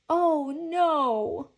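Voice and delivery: high, loud drawn-out wail; loud, dramatic cry of despair. A narrator's reading. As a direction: wail in dismay. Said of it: "wailed Franklin".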